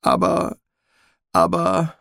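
high, slow, trembling voice. Acted. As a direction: quaver with fear on each word. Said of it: "mit zitternder Stimme".

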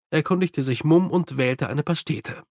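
usual speaking voice

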